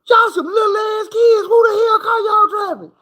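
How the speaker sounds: put-on can't believe kids are driving; shocked shout.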